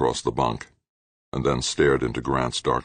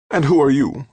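higher, louder, sharp demand; an alarmed woman challenging a stranger; suspicious woman; 12 semitones higher, 7 dB louder than usual. A narrator's reading. Woman on screen questioning Grant, higher and louder. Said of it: "the woman asked".

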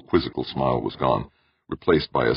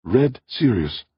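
slow, high gasp of realisation; stunned, pitched up; 9 semitones higher, slower than usual. A narrator's reading. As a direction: gasp it out, drawn slowly. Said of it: "Elise gasped".

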